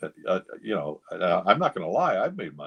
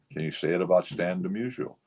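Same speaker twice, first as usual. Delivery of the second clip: usual speaking voice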